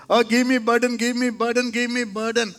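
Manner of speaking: mock pleading. Some people begging heaven, mild mock plea. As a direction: parody people begging for a burden. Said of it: "some people will say".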